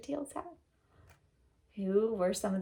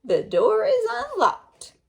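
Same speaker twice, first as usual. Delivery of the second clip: high, slow, laughing fake granny; wolf luring her in; 10 semitones higher, 9 dB louder, slower than usual. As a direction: hide the wolf behind a granny voice. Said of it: "said the wolf".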